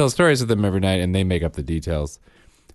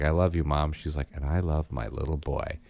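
tender soft; 4 semitones lower, 6 dB quieter than usual. A narrator's reading. Sweet bedtime story ending.

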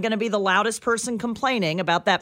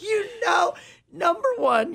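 laughing; 10 semitones higher than usual. Real, not acted. Laughing after colleague's flub.

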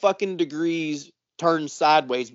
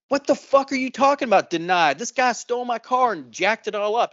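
indignant retort; 6 semitones higher than usual. Retold or imitated. Speaker snapping back at the insurance rep, high and indignant. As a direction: fire back in disbelief at the claim denial.

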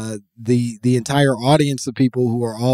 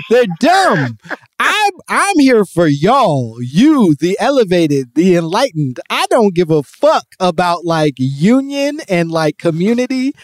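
high, louder mock oratory; raised, emphatic impression of a rant; imitated speaker's rant; 6 semitones higher, 4 dB louder than usual. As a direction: mimic a speaker preaching to his followers. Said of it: "where he's like".

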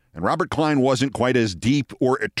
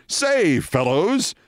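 high, lilting call to the fellows; jovial three-piece-suit type hailing the men; hearty suited gent; 5 semitones higher, much slower than usual. Retold or imitated. Suited producer greeting a group of men, hearty gent voice. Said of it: "three-piece suit kind of guy".

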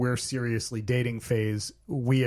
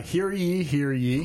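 put-on old-time town crier call; bailiff; 3 semitones higher, slower than usual. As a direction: announce it like a herald. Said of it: "as all good statements start in the eighteen hundreds".

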